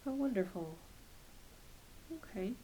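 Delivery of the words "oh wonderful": usual speaking voice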